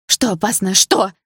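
loud demanding shout; cry out. A narrator's reading. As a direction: shout the question at him. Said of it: "выкрикнула я". (громкий выкрик)

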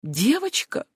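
high, rising moaned question; dismayed moan. A narrator's reading. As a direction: moan it in mild disbelief. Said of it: "простонала Фанни". (стонущий, растерянный вопрос)

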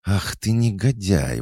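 high-pitched outraged scolding; indignant. A narrator's reading. Cat goddess scolds her commanding owner, indignant. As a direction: scold him in high, affronted tones. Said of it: "возмутилась Устинья".

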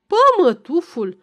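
loud, high cry of "Pămătuful!" with a falling end; teacher's wife shouting the nickname at the passing priest; shouted nickname. A narrator's reading. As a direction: fling "Pămătuful!" out the window as an insult. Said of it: "a strigat dăscălița".

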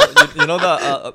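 laughing. Real, not acted. Laughing while describing wrestling.

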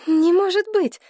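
high, agitated denial; shocked high-pitched protest; disbelieving. A narrator's reading. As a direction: refuse to believe it, pitch up high. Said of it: "повторяла Елена Павловна, мотая головой".

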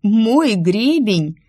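slow, drawn-out astonished exclamation. A narrator's reading. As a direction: stretch the words out in amazement. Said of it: "открыла от изумления рот". (изумлённо, медленно растягивая слова)

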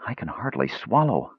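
dry, pained and soft; hurt throat, words squeezed out; said painfully. Acted. Parched traveller complains of a dry throat, painfully. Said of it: "Brandella said painfully".

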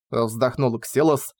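usual speaking voice

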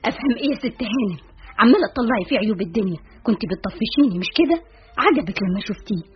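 fast, indignant tirade; angry outburst. Acted. Betrayed friend confronting her friend over a suitor, angrily. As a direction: fire off accusing questions in a rush. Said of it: "هاتفة بغضب".

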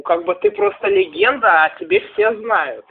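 usual speaking voice

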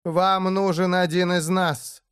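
high, slow, slightly hoarse delivery. A narrator's reading. Деэмира bargains with the orc over the gate, hoarse voice. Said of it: "произнесла она хриплым голосом".

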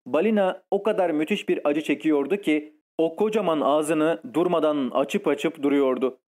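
plain reading voice

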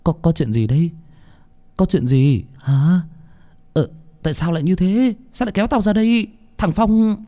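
stammering questions climbing high; bewildered protest, pitch rising as it goes; flustered panic. A narrator's reading. Bình asks Phong why he dragged him away, flustered panic. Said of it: "hốt hoảng".

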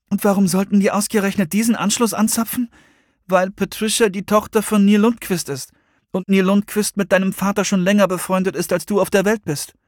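lightly voiced, high-pitched argument; high character voice, question and explanation; girls in tense dialogue. A narrator's reading.

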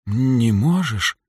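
slow, gently wondering question; dying girl surprised he can't. A narrator's reading. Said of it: "удивилась Лирейн". (удивлённо, медленно)

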